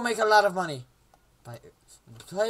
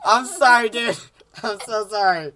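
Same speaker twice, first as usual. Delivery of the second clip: loud, high, cracking up; laughing apology; 4 semitones higher, 10 dB louder than usual. Real, not acted. Player apologising to friend, laughing hard.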